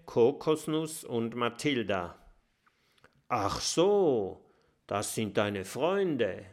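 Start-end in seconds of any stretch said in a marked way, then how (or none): none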